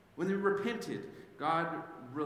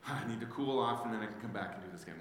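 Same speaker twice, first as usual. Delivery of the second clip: mild cool-off; 5 semitones lower, 3 dB quieter, faster than usual. Retold or imitated. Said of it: "he wasn't just like".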